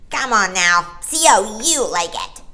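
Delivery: loud jeering seal; mock them from the pool; bitter taunt. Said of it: "a bitter, angry voice".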